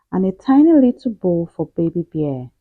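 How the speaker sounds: usual speaking voice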